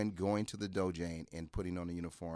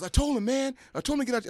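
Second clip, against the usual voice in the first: high, louder indignant protest; much higher and louder, pleading his innocence; retold protest; 11 dB louder, faster than usual. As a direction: whine it like a tattling teammate. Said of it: "And so Eddie goes".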